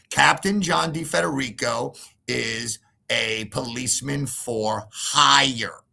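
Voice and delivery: usual speaking voice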